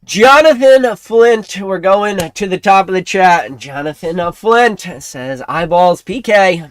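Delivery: slow, loud, drawn-out name call; announcer callout. Real, not acted. Streamer shouting out a chat member, announcer style. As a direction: announce the name like a ring intro.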